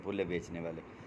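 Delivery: normal talking voice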